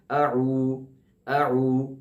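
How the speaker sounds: اعو with fully rounded lips; rounded ain. Shown as a demonstration. Teacher modelling اعو for students to repeat. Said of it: "منہ کو مکمل گول کرنا ہے".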